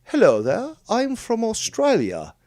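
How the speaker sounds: high, loud faux-Aussie accent; badly faked Australian, stretched out; fake Australian accent. Non-Aussie convincing people he is Australian, hammed-up fake Australian accent. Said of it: "make it sound like".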